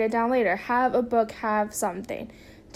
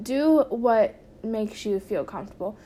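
normal talking voice

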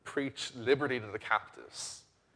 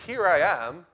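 loud, raised-pitch self-introduction; sudden announcement; 6 semitones higher, 10 dB louder, slower than usual. Acted. God presenting himself to Gentiles, sudden announcement. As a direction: step in boldly with the voice, louder and higher.

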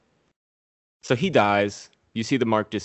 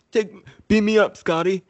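much higher, louder hammed-up quote; mock Star Trek line; 12 semitones higher, 6 dB louder than usual. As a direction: ham up the famous line. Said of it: "And he goes like".

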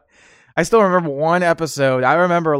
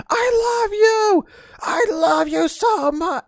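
very high, slow, crying yell; hammed-up sobbing declaration; mock wailing shout; much slower than usual. Man outside screaming to girlfriend, high slow wail. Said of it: "he was screaming at his girlfriend".

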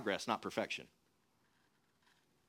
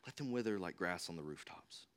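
almost to yourself, as the point sinks in; quiet, low repeat; 11 semitones lower, 12 dB quieter than usual. Real, not acted. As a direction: lower and much softer. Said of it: "repeated quietly".

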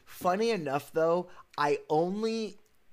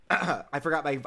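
ordinary delivery

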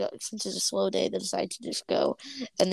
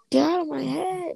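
high-pitched mock yell; 8 semitones higher than usual. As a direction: yell it high without much extra volume. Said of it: "starts yelling".

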